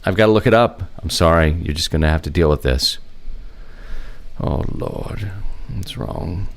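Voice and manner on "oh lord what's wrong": soft, under-the-breath grumble; muttering to himself. Real, not acted. Host fumbling to look up a record, muttering. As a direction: mutter it half-voiced, off to yourself. Muttering while looking something up.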